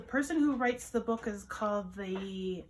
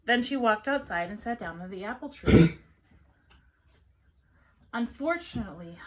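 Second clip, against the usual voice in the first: ordinary delivery, nothing marked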